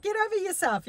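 high, scornful snap; imitated retort, pitched high; mock telling-off. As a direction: brush him off with contempt. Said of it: "You know, like".

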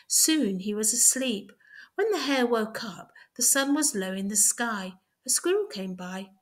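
ordinary delivery, nothing marked

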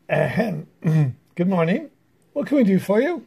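loud, formal chief frog clearing his throat; pompous chief frog. Acted. The chief frog formally greeting the pig, loud and pompous. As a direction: puff up like an important frog.

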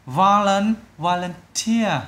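"Volun-, volunteer" slowly, stress landing on TEER; third-syllable stress. Shown as a demonstration. Teacher showing the stressed syllable, third-syllable stress. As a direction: break off, then stress the end of "volunteer". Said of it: "âm ba luôn".

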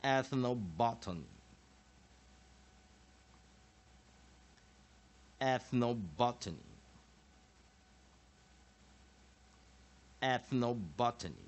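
"Ethnobotany" modelled as a lone word, BOT stressed; measured teaching repeats of "Ethnobotany"; listen-and-repeat drill. Shown as a demonstration. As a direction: say "Ethnobotany" for learners, long gaps between. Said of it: "再做一次练习".

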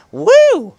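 very high, loud "woo"; excited whoop. Real, not acted. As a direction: let out a loud celebratory whoop. Cheering at end of game demo.

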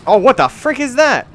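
high, loud startled outburst. Real, not acted. Gamer reacting to a sudden strange thing, startled. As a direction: blurt it out in alarm.

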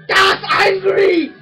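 slow, loud mock German shout; German accent. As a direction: bark it like an angry German. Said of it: "He'll be like".

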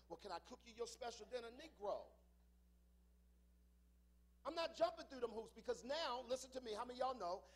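loud, rising preacher's cadence; preaching at full force. Acted. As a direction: drive it home to the congregation.

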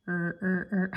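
"Er, er, er." as short separate r sounds; repeated letter sound. Shown as a demonstration. Teacher prompting for a letter, soft repeated r. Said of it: "What letter here?".

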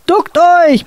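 high sharp shouted warning; bellowing. A narrator's reading. Captain roars at the crew to duck. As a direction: roar the warning. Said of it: "brüllte der Kapitän".

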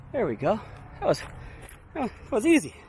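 panting after the climb; breathless from climbing through; out of breath. Real, not acted. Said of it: "I don't know why I'm out of breath".

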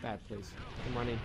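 ordinary talk, nothing marked

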